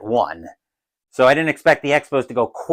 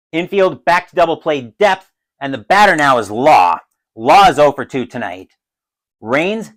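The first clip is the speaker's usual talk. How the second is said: ordinary talk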